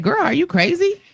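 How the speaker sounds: high mock-shocked friend's cry; imitating shocked friend. Quoting her friend on FaceTime, high and shocked. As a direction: toss it up high, incredulous.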